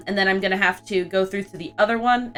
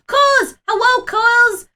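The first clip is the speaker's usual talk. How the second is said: light up greeting a friend; excited greeting; 14 semitones higher, 6 dB louder than usual. Real, not acted. Streamer spotting a friend in chat, gasping and calling out high. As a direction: gasp then a much higher, louder hello.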